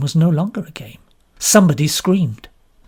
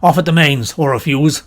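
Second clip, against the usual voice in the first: brisk colonel; 6 dB louder than usual. A narrator's reading. Colonel giving orders.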